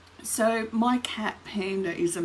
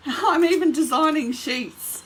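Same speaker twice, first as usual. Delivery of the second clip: laughing; 7 semitones higher, 7 dB louder than usual. Real, not acted.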